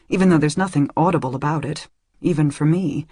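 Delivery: usual speaking voice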